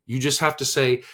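ordinary talk, nothing marked

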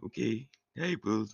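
lightly done robot voice; flat mock robot reply. Shown as a demonstration. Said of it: "robot doesn't sound like that at all".

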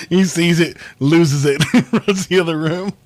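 laughing through the words; telling it while cracking up. Real, not acted. Laughing while retelling prank.

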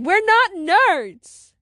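loud, high, drawn-out objection; offended kid calling back; indignant protest. Acted. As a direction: stretch it out loudly as you protest. Kids protest being called nerds.

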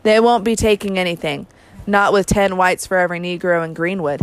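plain reading voice

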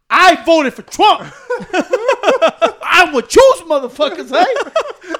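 high, slow shout breaking into laughter; comic loud declaration. Retold or imitated. Announcing his vote to staring onlookers, slow comic holler.

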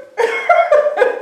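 loud high burst of laughter; real laughter, loud and high. Real, not acted. Laughing after tearful impression.

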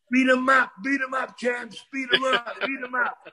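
retold yelling. Man outside the ring urging on the champs, retold yelling. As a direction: imitate someone egging on fighters, not full volume. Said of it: "he yells out".

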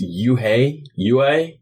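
Uhay said slow with a glide, like yoo-hay; Mississippi E. Shown as a demonstration. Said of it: "When Mississippians say E".

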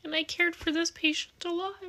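real tears rising, long pauses between words; fighting back tears while speaking; tearful. Real, not acted. Telling viewers about a dying patient, tearful. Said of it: "sorry, I'm gonna cry".

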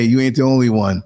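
ordinary talk, nothing marked

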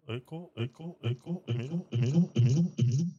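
testing the mic with echo. Real, not acted. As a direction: voice with a room echo. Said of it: "Tiene eco".

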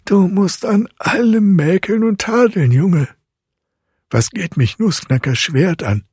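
high, irritated godfather's rebuke; indignant scolding. A narrator's reading. Godfather rebukes Fritz for finding fault, high and indignant. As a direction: scold in a high, emphatic, annoyed voice. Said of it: "erwiderte der Obergerichtsrat ganz unwillig".

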